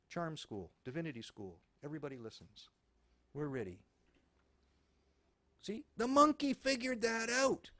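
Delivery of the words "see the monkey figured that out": triumphant. Real, not acted. Mocking triumph.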